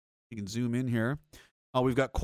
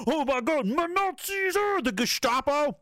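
mocking whine; 9 semitones higher than usual. Mocking police apologists in a high whiny voice. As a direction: sneer at their excuse in a whiny falsetto. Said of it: "The difference between you and this".

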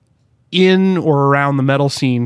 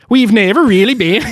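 put-on Swedish accent; 7 semitones higher, 4 dB louder than usual. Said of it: "Can you do it in a Swedish accent?".

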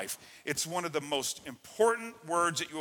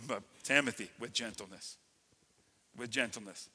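much softer and lower; gentle; 6 semitones lower, 9 dB quieter than usual. Acted. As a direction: drop to a hush on gentleness. Contrast: gentleness said softly.

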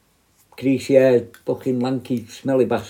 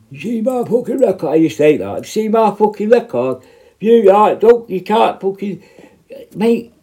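higher, lightly imitated bragging inmate; mock boastful prisoner; 8 semitones higher, 4 dB louder than usual. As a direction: throw his record in my face, cocky. Mimicking prisoner boasting of record.

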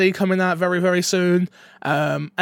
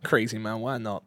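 low mumbled shrug, much softer; mumbling shrug; 7 semitones lower, 7 dB quieter than usual. Retold or imitated. Host imitates his co-host shrugging along, muttered softly. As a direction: mutter it off like a bemused guest. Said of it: "You're like".

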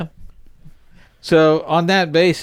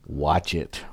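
low threat; 10 semitones lower, 8 dB quieter than usual. Pretend. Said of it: "you say".

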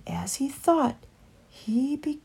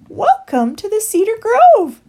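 high, loud fluting coo; high-pitched birdlike lilt; cooing dove; 13 semitones higher, 11 dB louder than usual. A narrator's reading. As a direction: coo a welcome like a bird. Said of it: "she cooed".

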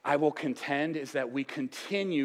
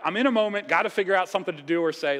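flustered Peter; 5 semitones higher, 6 dB louder, much faster than usual. Retold or imitated. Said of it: "He's like".

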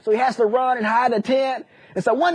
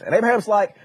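normal talking voice

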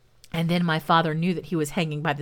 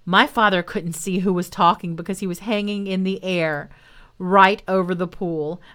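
ordinary delivery, nothing marked

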